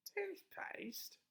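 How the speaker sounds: high, whispered disbelief; surprised whisper. A narrator's reading. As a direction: gasp it in shock.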